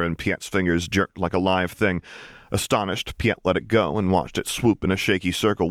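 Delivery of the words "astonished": ordinary delivery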